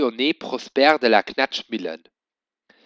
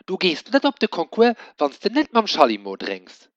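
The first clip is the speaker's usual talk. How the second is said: high, insistent scolding tone; scolding lady; 7 semitones higher, 4 dB louder than usual. A narrator's reading. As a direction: wag a finger at the cat.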